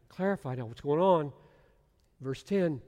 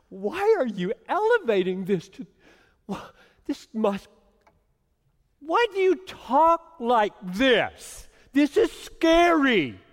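higher and louder, slow, half-playful alarm; mock alarmed protest; 10 semitones higher, 10 dB louder than usual. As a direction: protest to Jesus as if spooked. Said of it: "I pause and I say".

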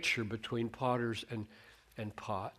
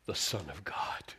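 hushed whisper; 6 semitones lower, 5 dB quieter than usual. Acted. Angel's words end hushed.

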